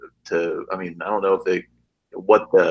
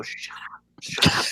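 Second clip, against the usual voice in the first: whispered, urgent hush; frantic little whisper; 7 dB quieter, slower than usual. Acted. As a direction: whisper it to silence the cat. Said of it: "is gonna go".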